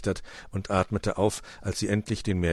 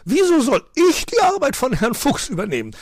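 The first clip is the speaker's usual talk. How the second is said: loud, very high protest; shouting, indignant bird; complaining magpie; 11 dB louder than usual. A narrator's reading. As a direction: squawk in outraged refusal. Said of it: "beschwerte sich Frau Elster".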